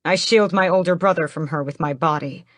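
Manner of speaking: ordinary delivery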